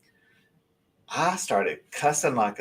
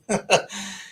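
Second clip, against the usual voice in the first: breathy laugh; 8 semitones higher than usual. Real, not acted. Laugh after fascinating.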